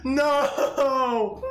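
high, drawn-out whine of regret; high comic despair; laughing lament. Real, not acted. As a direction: wail about your own blunder. Lamenting own blunder.

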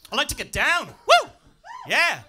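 high, loud funk-singer whoop. Pretend. Comic doing a mock [name] to the crowd, whooping. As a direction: hype it up like a soul singer. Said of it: "Not down like [name]".

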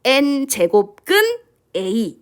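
very slow, spaced-out "N 제곱근 A"; stretched teacher model with a pause before A; read-along demo. Shown as a demonstration. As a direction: lead students through it piece by piece. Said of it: "다시 따라해볼까요?".

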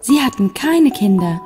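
ordinary delivery

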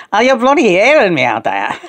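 emphatic Scouse repeat, punching "bloody". Pretend. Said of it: "Talk scours".

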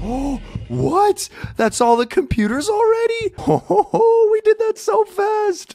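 high, laughing disbelief; laughing surprise. Real, not acted. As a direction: laugh it out in shock.

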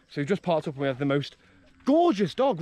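ordinary talk, nothing marked

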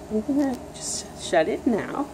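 a soft whisper. Real, not acted. Parent whispering to the baby while closing the book.

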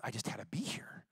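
soft whispered reply. Retold or imitated. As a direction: breathe it like a sick man. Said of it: "He goes".

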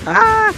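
a real jump-scare shriek; real scream of fright. Real, not acted. Monster jumps at him. (grito agudo de susto real)

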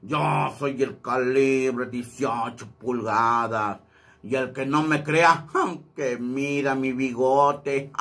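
slow pompous Calibre. Shown as a demonstration. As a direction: boast like a swaggering macho. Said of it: "así es como interpreto el calibre".

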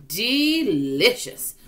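drawn-out. A narrator's reading. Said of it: "said the old green grasshopper".

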